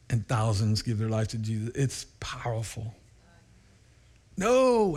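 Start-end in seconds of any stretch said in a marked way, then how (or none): 4.4-5.0 mock warning. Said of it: "I tell them".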